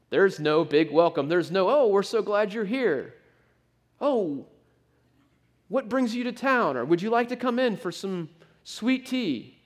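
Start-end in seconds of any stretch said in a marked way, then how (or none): 1.7-6.8 high, gushing mock host; high, syrupy southern-hospitality welcome. 6.8-9.6 gushing welcome. Retold or imitated. Mock host offering sweet tea.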